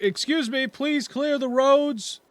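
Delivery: high, loud guard announcing to the crowd; guard calling out. Acted. Guard ordering citizens off the road, loud and high. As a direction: call out to clear the street. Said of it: "it's like".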